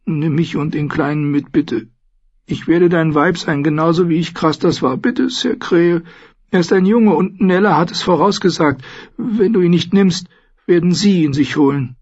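high-pitched desperate begging; pleading in a high, weepy voice; tearful pleading. A narrator's reading. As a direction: beg as a young mother in tears. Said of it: "Goldie weinte".

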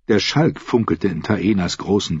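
usual speaking voice

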